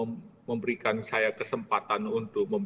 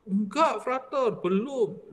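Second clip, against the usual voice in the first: higher, firm denial; insistent maid; 9 semitones higher, slower than usual. Retold or imitated. Maid denies to Frater she was paid, high and firm. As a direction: flatly deny it, pitched up.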